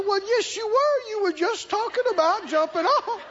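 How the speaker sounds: high-pitched nagging comeback; insistent accusation. Acted. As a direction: argue back hard, pitched right up. Devil argues back, acted quarrel.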